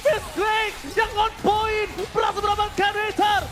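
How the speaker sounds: loud, rising hype call; excited shout. Real, not acted. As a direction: shout the big play out. Big play called live.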